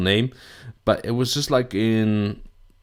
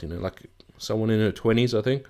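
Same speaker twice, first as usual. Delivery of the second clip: ordinary talk, nothing marked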